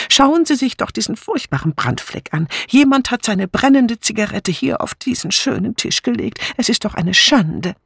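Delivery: high-pitched, fussy complaint; disapproving. A narrator's reading. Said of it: "bemerkte Dora Banner missbilligend".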